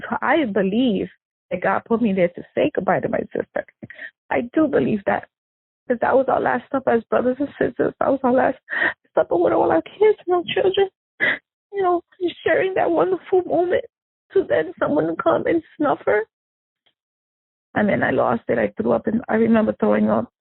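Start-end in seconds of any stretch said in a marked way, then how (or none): 4.3-16.2 real grief. Real, not acted. Sister recalling their last moment together, grieving. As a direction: voice climbing high with grief.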